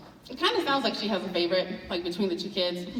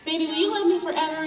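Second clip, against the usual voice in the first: high, fast, pleading; sweet girlfriend voice; 7 semitones higher, 3 dB louder, much faster than usual. Retold or imitated. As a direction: coo at the boyfriend for reassurance. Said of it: "I ask him".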